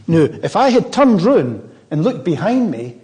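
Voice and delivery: ordinary talk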